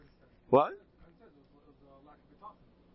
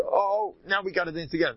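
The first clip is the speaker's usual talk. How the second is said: higher, playful sudden realization; mock eager excuse; 6 semitones higher than usual. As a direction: imitate someone delighted to find a pretext.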